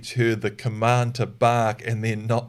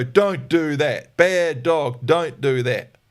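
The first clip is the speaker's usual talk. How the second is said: slow, scolding "don't do that"; mild drawn-out telling-off; nagging scold; 3 semitones higher, 4 dB louder, much slower than usual. Retold or imitated. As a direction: keep nagging after the dog already stopped. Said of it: "some people will go".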